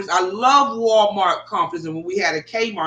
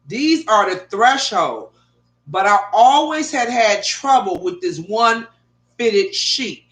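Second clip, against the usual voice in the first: normal talking voice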